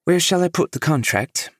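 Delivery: a polished English assistant voice; a poised English accent, lightly lifted; elegant English accent. A narrator's reading. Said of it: "in an elegant English accent".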